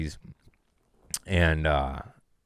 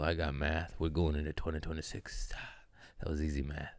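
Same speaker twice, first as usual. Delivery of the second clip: usual speaking voice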